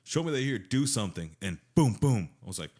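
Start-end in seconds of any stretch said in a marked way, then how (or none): 0.0-1.4 raised-pitch taunting challenge; provoking. Retold or imitated. Man provoking ghosts like the TV show, taunting challenge. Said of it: "So I start provoking". 1.8-2.3 two booming hits, much louder; imitated loud thuds. Storyteller imitating the ghost's answer, loud booming thuds.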